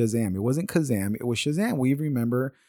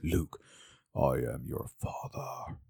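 slow, low, hushed villain voice; Darth Vader; 9 semitones lower, 10 dB quieter, slower than usual. Retold or imitated. Quoting the famous movie line as everyone does, in a deep hushed villain voice. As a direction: drop it deep and whispery, drawn out. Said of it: "say it in that voice".

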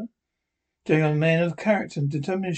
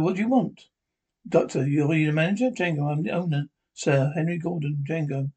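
ordinary talk, nothing marked

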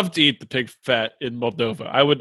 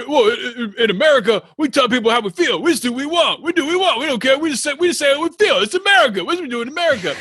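Charlie screaming; 9 semitones higher, faster than usual. Retold or imitated. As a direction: imitate a worked-up shouter in a much higher voice. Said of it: "Charlie's screaming at Andre".

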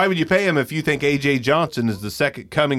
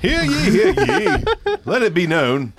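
town crier; 13 semitones higher, slower than usual. Pretend. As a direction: cry it out like a herald, cracking up. Mock proclamation, laughing.

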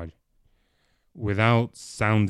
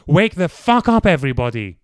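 furious shout, much higher and louder; 5 semitones higher, 10 dB louder than usual. Acted. Clive explodes at everyone, furious shout. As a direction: bellow at the whole room. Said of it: "Clive shouted".

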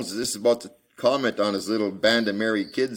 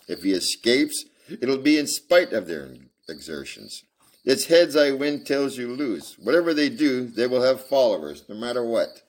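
reading a Sherlock Holmes novel aloud; story narration; 4 semitones higher, 4 dB louder than usual. Acted. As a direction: read it out like a storyteller.

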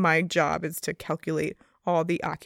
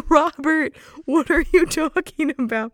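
high, loud disbelieving call of his name; incredulous; 8 semitones higher, 7 dB louder, slower than usual. Retold or imitated. Telling off a coworker who stayed inside during a fire, a high loud call.

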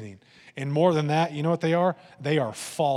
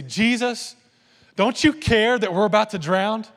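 higher, louder cry of alarm; urgent cry; 6 semitones higher, 6 dB louder than usual. Acted. Disciples crying to Jesus as they fear drowning, urgent and loud. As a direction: call out to be saved from danger. Acting out panicked disciples on boat.

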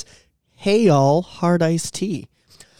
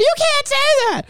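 high-pitched shocked daughter; scandalized daughter. Retold or imitated. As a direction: squeal it like a disapproving daughter. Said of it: "his segment in his comedy where he's like".

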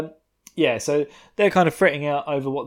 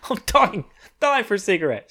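higher-pitched hammed-up craving; put-on urgent begging; mock desperate pleading; 6 semitones higher than usual. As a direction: plead as if gasping for a smoke. Acted begging for a cigarette.

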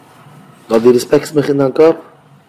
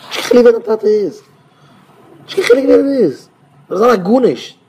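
high excited reading; 10 semitones higher, 3 dB louder than usual. Real, not acted. Child reading aloud, excited.

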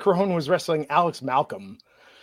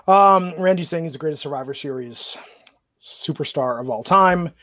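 normal talking voice

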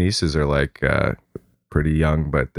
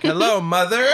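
high, put-on child voice; hammed-up high greeting; weird kid; 11 semitones higher than usual. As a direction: imitate an oddball kid greeting mom. Odd child addressing mom.

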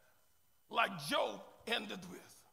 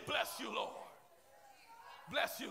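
ordinary talk

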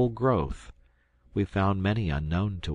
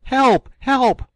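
very high, loud scream for help; shrieked, panicked call; piercing cry; 11 dB louder than usual. A narrator's reading. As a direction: scream for rescue in terror. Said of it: "Jack uttered a piercing cry".

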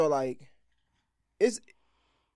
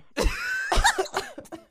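cough. Real, not acted.

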